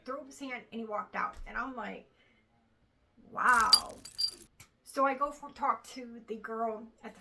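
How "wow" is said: stunned wow. Retold or imitated. Doctor walks out on her.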